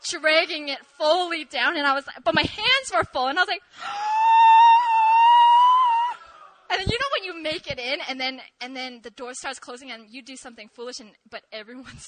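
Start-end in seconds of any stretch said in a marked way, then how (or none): 3.7-6.5 a loud, high, horrified gasp; shrieking gasp. Retold or imitated. Scarf sliding into the crack, a shrieking gasp. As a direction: gasp like watching disaster happen.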